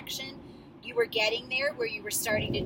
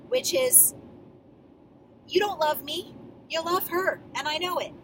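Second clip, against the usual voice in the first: higher, louder, impassioned; heated, higher voicing of Helena's inner thoughts; 8 semitones higher, 3 dB louder than usual. Shown as a demonstration. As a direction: spill out the character's hurt thoughts. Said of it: "what am I thinking?".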